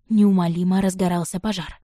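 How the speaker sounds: ordinary delivery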